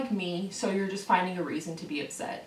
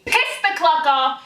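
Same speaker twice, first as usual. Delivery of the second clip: emphatic outburst; 6 semitones higher, 10 dB louder, slower than usual. Real, not acted. Rant, emphasizing being pissed off.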